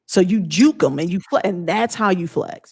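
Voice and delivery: normal talking voice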